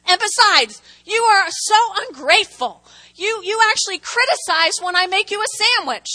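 loud, high, indignant re-enacted rant; angry eruption. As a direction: hurl the accusation at full volume. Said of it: "second eruption, they come in threes".

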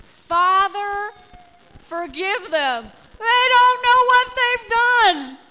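high, drawn-out anguished shout; crying out. Acted. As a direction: cry it out slowly at full voice from the cross. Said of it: "cried out".

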